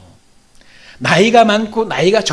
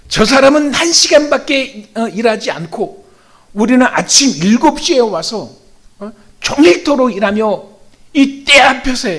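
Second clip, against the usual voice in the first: acted indignant protest, raised and louder; angry complaint; 3 semitones higher, 4 dB louder than usual. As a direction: protest the unfairness as an aggrieved worker. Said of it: "막 화를 내는 거예요".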